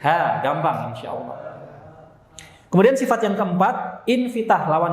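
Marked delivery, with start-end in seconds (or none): 0.0-0.4 "Ha" with light open A; tongue kept low on "Ha"; plain, unrounded "Ha"; thin H. Shown as a demonstration. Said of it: "Pangkal lidah enggak naik".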